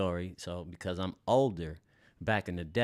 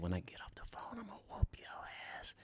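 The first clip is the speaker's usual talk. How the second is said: hushed, unvoiced mom threat; covered-phone threat; 6 semitones higher, 11 dB quieter, faster than usual. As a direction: whisper the threat behind a hand over the phone. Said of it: "she covered the phone and said".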